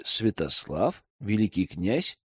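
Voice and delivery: slow, low disbelief with rising ends; surprised questioning. A narrator's reading. Elder learns the prince trained the girl, surprised. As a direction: draw out each question in quiet astonishment. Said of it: "Я удивлённо поднял брови".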